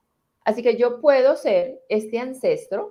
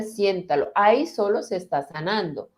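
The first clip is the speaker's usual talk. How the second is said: usual speaking voice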